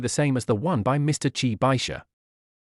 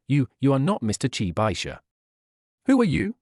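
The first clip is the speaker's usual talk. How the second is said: trembling voice. A narrator's reading. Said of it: "said in a trembling voice".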